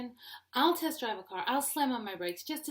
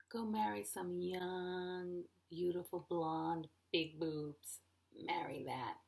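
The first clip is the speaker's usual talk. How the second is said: slow, lowered, drawn-out dare; mock generous offer; 2 semitones lower, 5 dB quieter, slower than usual. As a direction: dangle the fantasy slowly, dropping in pitch. Acted retort to husband in fights.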